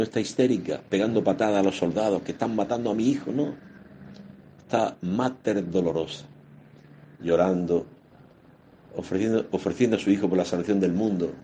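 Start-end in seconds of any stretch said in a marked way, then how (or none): none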